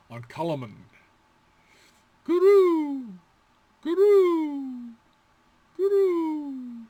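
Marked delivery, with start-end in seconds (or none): 2.3-6.9 high dove coo. Shown as a demonstration. Teacher cooing like a dove, high and loud.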